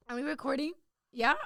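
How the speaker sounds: ordinary talk, nothing marked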